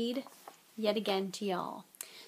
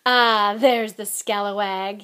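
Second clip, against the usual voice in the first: loud, drawn-out jovial greeting; beaming; 11 dB louder, slower than usual. A narrator's reading. Lockhart greeting Harry at his office door, beaming. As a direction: beam it out, big and slow. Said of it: "Lockhart beamed down at him".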